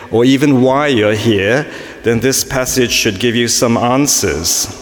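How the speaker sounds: ordinary delivery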